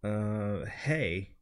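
low, flat, reluctant greeting; aloof grunt. Acted. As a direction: brush off the newcomer, low. Plays aloof Jim.

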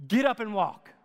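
"Get up and walk" as a loud, falling command; sovereign command. Shown as a demonstration. Preacher voicing Jesus healing the lame man, commanding. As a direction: bark "Get up and walk" with full authority. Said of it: "Do you hear that sovereign statement".

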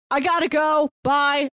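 ordinary talk

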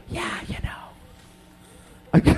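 slow, soft drawn-out whisper. Pretend. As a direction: breathe it out slowly and secretively.

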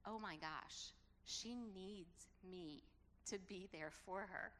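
ordinary talk, nothing marked

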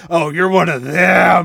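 loud slow accusing cry; hammed-up accusing detective; mock accusing shout. Detective character quoted in game.

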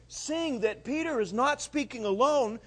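normal talking voice

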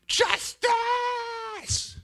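very high, drawn-out mock scream; exaggerated high cry for payback; demanding justice. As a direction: shriek it in hammed-up outrage. Mock outrage at a post.

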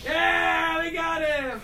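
high, excited cheer; triumphant shout. Acted. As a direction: cry out in victory. Acting out Hammy's triumphant attack.

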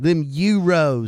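high, loud clueless tourist; dumb American tourist. Pretend. Mocking an American tourist in Germany, loud. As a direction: shout like a lost tourist.

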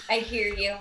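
ordinary delivery

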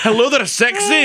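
high Scottish Austin Powers character impression; hammed-up Scottish come-on; Scottish accent. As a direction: put on a high, flirty Scottish voice. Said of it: "what's his name from Austin Powers?".

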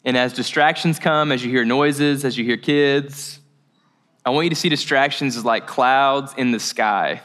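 calm, subdued meditation guide; guided meditation. Real, not acted. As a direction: settle a group into stillness, pausing between phrases. Leads breathing and meditation.

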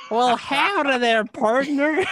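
high, loud cartoon cowboy accent; a hammed-up Western drawl, like a Looney Tunes cowboy. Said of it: "Pete wants to hear your accent".

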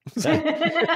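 sudden bright burst of laughter; real laugh at a friend's joke; loud high laugh. Real, not acted.

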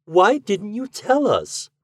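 high, slow, gentle reproach; tender reproach. A narrator's reading. Wife asking the bride gently, high slow reproach. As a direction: ask with loving pity.

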